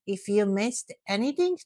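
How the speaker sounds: normal talking voice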